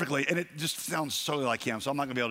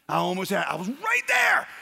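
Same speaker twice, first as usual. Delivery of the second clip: rising frustrated yell, much higher and louder; mock-frantic greyhound cry; excited dog voice; 5 semitones higher, 6 dB louder than usual. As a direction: shout it like you just missed the prize. Dog retold near-miss.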